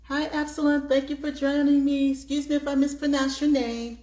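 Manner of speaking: loud, high cheery welcome; excited greeting. Real, not acted. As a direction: greet a viewer with delight. Greeting a live chat viewer.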